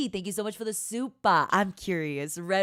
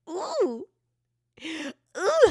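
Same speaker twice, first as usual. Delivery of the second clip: ordinary delivery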